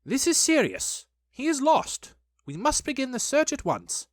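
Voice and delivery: high, urgent, self-important pronouncement; urgent high-pitched declaration; self-important Rabbit. A narrator's reading. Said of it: "looked very important".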